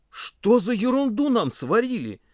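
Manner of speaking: high, slow, disgusted outcry; indignant exclamation. A narrator's reading. Pasha tastes the ruined soup, exclaiming in indignation. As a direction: drag the question out indignantly. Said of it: "воскликнул он".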